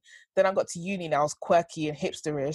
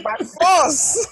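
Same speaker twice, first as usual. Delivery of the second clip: laughing; 12 semitones higher, 9 dB louder than usual. Real, not acted. Teasing banter, loud laughing.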